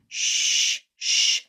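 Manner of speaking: voiceless "Shh", sustained; quiet sh sound. Shown as a demonstration. Teacher demonstrating mouth shape, quiet sustained sh. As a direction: hold "Shh" as pure air, no voice. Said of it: "Kinda like that quiet sound".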